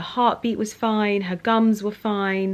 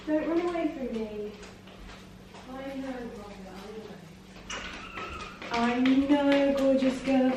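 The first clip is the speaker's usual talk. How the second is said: high, soft sing-song coaxing; 5 semitones higher, 8 dB quieter than usual. Real, not acted. Owner coaxing her frightened cat, high and soothing.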